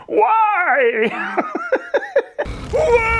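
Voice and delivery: high wailing monster cry; anguished monster. Retold or imitated. Narrator imitating the hurt monster, a high anguished wail. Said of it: "as he just goes".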